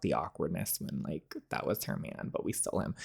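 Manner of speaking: hushed, breathy aside; whispered aside. Real, not acted. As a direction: drop to a whisper, gossiping. Whispered aside about the awkwardness.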